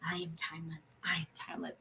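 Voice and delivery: soft breathy whisper to the mirror; hushed mirror mantra; whispered affirmation. Retold or imitated. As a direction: whisper it to yourself. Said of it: "she's like".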